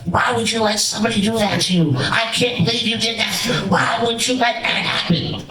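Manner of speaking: quick imitated furious rant; retold angry yelling. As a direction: rattle off the veteran's outburst, hammed up a little. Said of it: "it goes from ... to".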